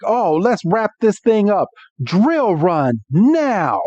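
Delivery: play-acted rallying command; battle command. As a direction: call the attack, stretching the final words. Acting out Iris's battle command.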